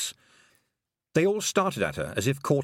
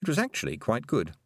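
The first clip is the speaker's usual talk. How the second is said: ordinary delivery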